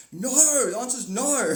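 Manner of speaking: loud, high emphatic no; shouted no. Acted. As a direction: shout down the wrong answer. Shouting the answer at them.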